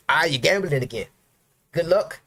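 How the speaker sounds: mocking the naysayers, dripping with sarcasm; sarcastic. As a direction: sneer it like a snide bystander.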